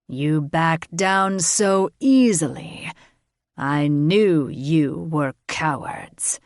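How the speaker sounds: slow, contemptuous pity; pitying scorn. A narrator's reading. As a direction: look down on the cowards. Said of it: "his tone changing from anger to pity".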